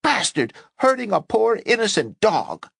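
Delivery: shrieking woman kicking the doctor. Acted. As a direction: shriek it while kicking him.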